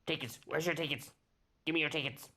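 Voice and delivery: high, quick squeaky pixie; small creature's high-pitched pestering. Acted. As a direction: demand it as a squeaky little pixie. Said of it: "in a high squeaky voice".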